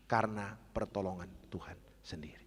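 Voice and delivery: soft reverent whisper. Real, not acted. Pastor whispering his thanks to God before the congregation. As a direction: breathe it out humbly.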